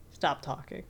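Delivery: breathy whispered, dismissive; whispered brush-off. Retold or imitated. Snake cutting off a panicking Brain in a flat whisper. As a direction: drop to a bored whisper.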